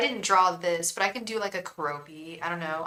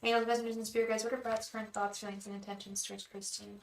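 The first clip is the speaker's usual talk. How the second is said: soft, hushed chanting to the spirit guides; whispered invocation; 9 dB quieter, faster than usual. Acted. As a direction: murmur the question over and over like a prayer. Tarot invocation repeated softly.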